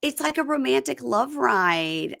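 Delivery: slow, drawn-out swooning imitation; hammed-up romantic rapture; mock gushing. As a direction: stretch the words out as if swept away. Acts out gushing on buggy ride.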